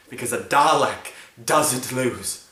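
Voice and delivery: loud, slow, emphatic anger; angry outburst. Acted. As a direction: hammer out each word in fury. Acted rage about the Daleks.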